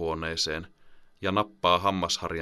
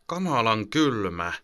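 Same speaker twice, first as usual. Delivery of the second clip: put-on slow, higher child's grumble; grumbling; 4 semitones higher than usual. Sleepy boy grumbles at his mother about the cold. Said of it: "hän murisee".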